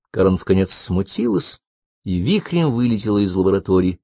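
ordinary delivery, nothing marked

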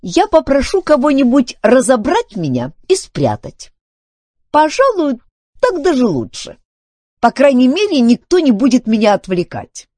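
high, loud robot voice making a threat; huffy robot. Acted. Filbert threatens Duncan with hiding away, huffy. Filbert plays his trump card.

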